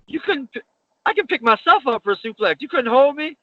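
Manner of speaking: high, disbelieving complaint; exasperated. Retold or imitated. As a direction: scold him for dropping you. Scolding partner after botched suplex.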